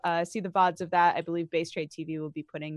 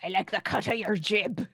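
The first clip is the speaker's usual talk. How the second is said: pirate voice. Shown as a demonstration. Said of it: "you should say that like a pirate".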